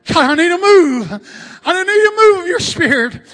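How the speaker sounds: loud, shouted prayer in bursts; excited plea. Acted. Preacher crying out for a move of God, excited. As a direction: shout to God with mounting fervour. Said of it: "He was excited about it".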